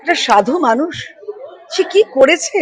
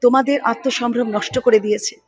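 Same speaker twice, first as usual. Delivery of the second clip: ordinary talk, nothing marked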